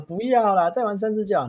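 put-on tired mother pleading with her child; pleading mom. Mom begs tired kid.

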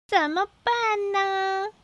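high, slow wondering; puzzled. A narrator's reading. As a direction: draw out the worry. Character wonders aloud.

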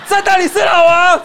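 high, loud and slow, still thrilled; excited shout. Retold or imitated. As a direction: stretch out the delighted cry. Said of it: "反应这么大啊". (放慢、兴奋地高声喊)

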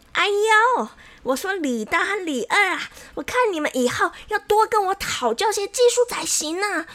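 high, showy smug scolding; condescending lecturing. A narrator's reading. Xiao Wang lectures rival barbers to their faces, smug. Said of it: "他甚至当面的教训起他们来了".

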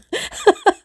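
squealing high shy giggle. Real, not acted. Shy host giggles at her own shyness, high squeal. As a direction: burst into a bashful squeal of laughter.

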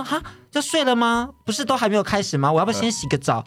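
surprised at being sent off early; mock surprised protest. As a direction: ask in mock disbelief. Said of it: "我就说".

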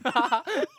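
high burst of laughter. Real, not acted. Laughing at the booger story. (大笑)

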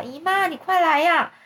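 high, panicked cry for help; panicked shout. Acted. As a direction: yell downstairs in a panic. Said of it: "惊慌地奔下楼， 大叫".

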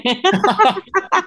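squealing laugh; high giggle. Real, not acted. Teased as astrologer. (高声咯咯笑)

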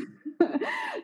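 bursting out laughing high; high burst of laughter. Real, not acted. Embarrassed husband story.

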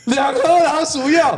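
high, slow, incredulous repeat; as if he can't believe what's in the box; mock shock at the rat poison; stunned disbelief.

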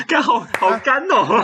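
slow laughing groan at a bad pun; drawn-out groan through laughter. Real, not acted.

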